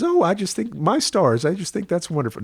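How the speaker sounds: put-on grandmother's deep Texas accent delighted at the news. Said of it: "that sort of deep Texas".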